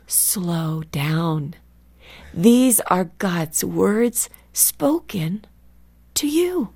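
measured, with weighty pauses between phrases; spaced-out, deliberate retold instruction; slow, solemn admonition. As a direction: imitate a teacher slowing readers right down. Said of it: "and say again".

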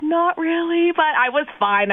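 high, mock-pitiful reply; hammed-up woe; dramatic. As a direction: play up the distress a little. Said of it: "I just was dramatic about it all".

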